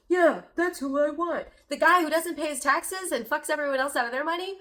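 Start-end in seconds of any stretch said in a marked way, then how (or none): 0.1-1.5 mock quote. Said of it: "mocking Trump supporter".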